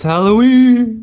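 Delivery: very high, loud Halloween squeal; excited squeal. Acted. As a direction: squeal it out with glee. Spooky Halloween announcement.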